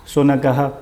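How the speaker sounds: शुनकः with a clear "ha" to finish; sounded visarga. Shown as a demonstration. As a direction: say शुनकः as "shunaka-ha". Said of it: "ह की ध्वनि निकलेगी".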